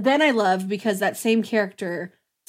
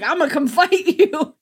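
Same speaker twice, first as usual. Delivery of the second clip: raised, louder comic voice with a laugh; eager mock challenge; 6 semitones higher, 5 dB louder than usual. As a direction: charge in with a silly battle cry. Acting out Morph rushing to fight.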